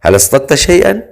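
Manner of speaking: teasing, sarcastic lift; mocking. A narrator's reading. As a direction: ask it with light mockery, rising at the end. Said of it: "أضاف بنبرة ساخرة".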